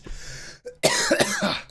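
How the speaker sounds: harsh hacking cough; coughing fit. Real, not acted. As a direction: cough hard, still choking. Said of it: "Are you all right?".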